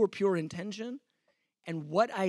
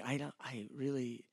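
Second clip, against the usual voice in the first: much softer, low hurt complaint; quiet hurt protest; 6 semitones lower, 11 dB quieter than usual. Retold or imitated. Preacher retelling his quiet protest at being mistreated, much softer. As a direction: voice it under your breath, wounded. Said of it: "and I'm going".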